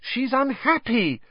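high-pitched, exasperated outburst; indignant, pitched well up. A narrator's reading. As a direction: protest as if they've missed the obvious.

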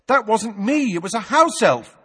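a hint of a boy protesting; boy blurting out a correction; lightly voiced boy. A narrator's reading. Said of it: "said Harry".

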